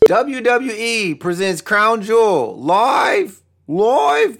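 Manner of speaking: slow, drawn-out ring announcer boom. Pretend. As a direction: stretch it like a big event announcer. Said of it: "Why did I say it like that?".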